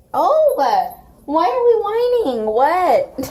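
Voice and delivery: very high, loud squeak voicing the dog; squeaky dog voice. Voicing her dog's whining in a high loud squeak. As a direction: squeak out the dog's whiny thoughts. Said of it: "and then he's like".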